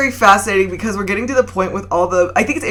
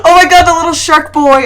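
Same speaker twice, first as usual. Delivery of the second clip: excited; 9 semitones higher, 9 dB louder than usual. Real, not acted. Delighted at sharks.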